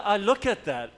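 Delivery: ordinary delivery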